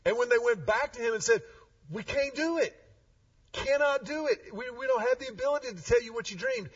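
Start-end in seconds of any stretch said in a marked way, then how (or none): 1.9-6.7 high, rushed pleading; panicky wise men. Retold or imitated. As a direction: beg the king, voice climbing higher.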